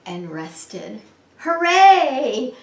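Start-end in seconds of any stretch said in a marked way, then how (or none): 1.4-2.5 loud, high drawn-out hooray; cheering. A narrator's reading. Owl and lion celebrate together, cheering. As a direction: let out a happy cheer. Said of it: "Owl and Arlo cheered".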